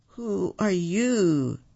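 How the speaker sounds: slow, frightened, high half-whisper; trembling voice. A narrator's reading. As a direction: draw it out fearfully, barely voiced. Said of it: "his voice trembling".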